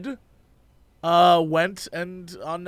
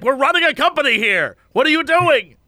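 mock outraged boss; 7 semitones higher, 5 dB louder than usual. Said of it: "And I'm like".

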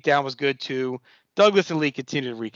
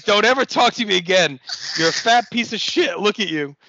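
raised, higher-pitched imitation of a furious wrestler; retold angry yell; 6 semitones higher, 3 dB louder than usual. Recapper acts out Taz chewing out Stevie, mock angry yell. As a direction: tell him off, mock-furious. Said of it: "just yells at Stevie".

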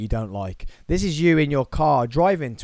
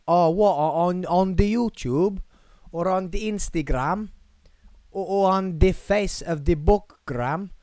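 higher, halting mock Eastern European voice; accidental Eastern European accent, pitched up; 6 semitones higher than usual. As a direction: put on a puzzled Eastern European questioner. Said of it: "went Eastern European then".

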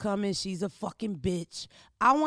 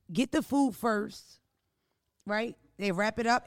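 ordinary talk

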